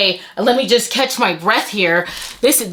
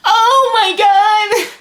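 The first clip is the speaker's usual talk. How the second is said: overwhelmed; 12 semitones higher, 3 dB louder than usual. Real, not acted. Overwhelmed opening gifts.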